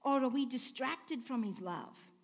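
usual speaking voice

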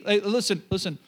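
ordinary delivery

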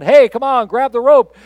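high, loud yell over a helicopter. Retold or imitated. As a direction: holler down from the helicopter. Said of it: "yells down".

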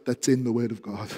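usual speaking voice

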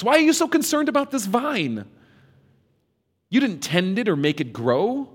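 incredulous God. Acted. God questions Jonah about vine.

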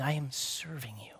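hushed whisper for Jesus' words; softly whispered line of Jesus. Acted. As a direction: breathe it out like a secret. Voicing Jesus' humble climax.

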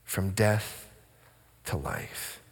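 very low, soft, solemn echo; grave near-murmur; hushed repetition. Real, not acted. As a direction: let it sink almost to nothing, pausing midway. Repeats the phrase for solemn effect.

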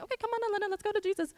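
put-on high-pitched children's-helper voice; Miss Nancy. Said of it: "It's not Miss Nancy saying".

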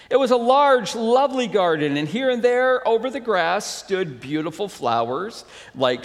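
story narration. A narrator's reading. Pastor reading The Selfish Giant to the congregation, lifted story narration. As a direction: read it out with lifted, emphatic storyteller energy.

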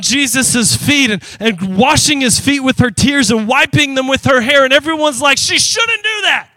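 loud, high, driving sermon voice; fiery preaching. Acted. Pastor urging the church to praise, fired up and loud. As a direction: preach it at full force to the church.

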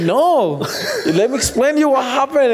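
high-pitched, laughing through the words; amused retelling, voice lifted by laughs; laughing while retelling. Real, not acted. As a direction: tell it through real laughter. Laughs while retelling grandma's alarm.

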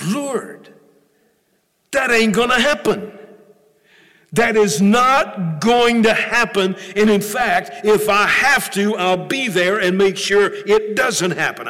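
loud, high-pitched emphatic protest; Peter. Acted. Peter disputing what Jesus said, loud protest. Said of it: "And Peter says".

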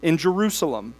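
steady, measured Bible reading to the church; congregation-facing scripture reading. Acted. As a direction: read the passage aloud with weight. Reads long Nehemiah passages.

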